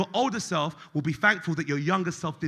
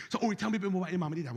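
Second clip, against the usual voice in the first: rushed therapist question; 7 dB quieter, much faster than usual. Retold or imitated.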